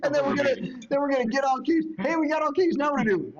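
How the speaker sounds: high, loud and eager; excited. Pretend. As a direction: get giddy about it. Excited fantasy of collecting.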